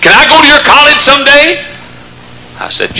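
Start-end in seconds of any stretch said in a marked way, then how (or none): 0.0-1.7 put-on high, eager teen question; excited teenager. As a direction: ask like a thrilled teenager. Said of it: "Had an excited teenager's voice".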